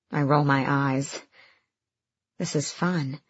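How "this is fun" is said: softly, as a private thought; quiet inner thought. A narrator's reading. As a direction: murmur it to yourself.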